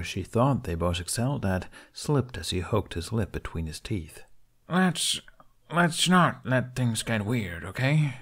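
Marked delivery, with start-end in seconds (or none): 4.7-8.1 slow, hesitant stammer, stress on not; nervous plea. A narrator's reading. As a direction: ease it out slowly, unsure.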